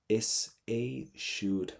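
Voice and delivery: "Is a should" word by word, slowly; slow pronunciation model. Shown as a demonstration. Teacher shows students two letters, slow careful "should". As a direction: spell out "Is a should" in slow, separate beats. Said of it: "So we've got two letters here".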